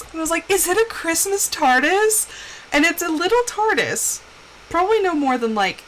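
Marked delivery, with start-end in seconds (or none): 0.5-2.3 re-enacted high-pitched, hopeful excitement; genuine excitement. Wife guessing her husband's Christmas gift, excited. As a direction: ask higher, thrilled to guess the present. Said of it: "And so I got so excited".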